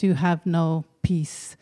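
plain reading voice

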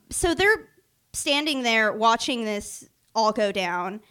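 ordinary talk

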